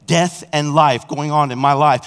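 normal talking voice

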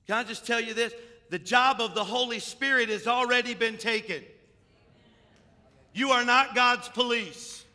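loud, high pulpit proclamation; full-force preaching. Real, not acted. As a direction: hammer it out to the congregation.

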